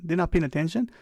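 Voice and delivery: ordinary delivery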